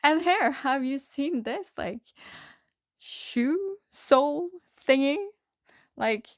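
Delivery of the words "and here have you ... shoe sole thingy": eager show-and-tell. Retold or imitated. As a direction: lightly imitate someone proudly presenting odd finds. Dude shows off odd finds.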